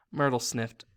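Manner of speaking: ordinary delivery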